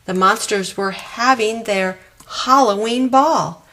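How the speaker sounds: ordinary delivery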